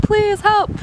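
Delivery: high, desperate mock plea; retold freaking out. Traveler begging laughing janitors to help, desperate. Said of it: "I was just freaking out".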